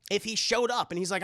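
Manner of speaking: ordinary delivery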